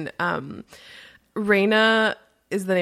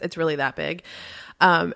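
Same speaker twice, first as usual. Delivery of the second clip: ordinary talk, nothing marked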